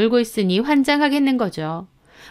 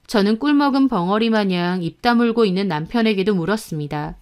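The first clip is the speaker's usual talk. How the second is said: usual speaking voice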